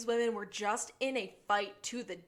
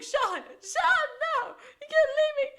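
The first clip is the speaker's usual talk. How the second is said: hammed-up sobbing, much higher, laughing through it; mock sobbing; 8 dB louder, slower than usual. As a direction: whimper like an abandoned Gus, high and comic. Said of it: "sobs to himself".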